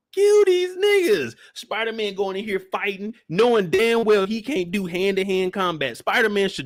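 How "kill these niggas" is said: high, loud, drawn-out plea; yelling at a movie hero to kill; mock yell at the screen. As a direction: beg him to finish the fight. Yelling at Spider-Man on screen.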